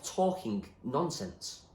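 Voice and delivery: ordinary delivery, nothing marked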